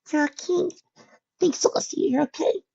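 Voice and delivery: high, breathy, near-sobbing relief; tearful relief. A narrator's reading. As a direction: whisper it through tears of joy.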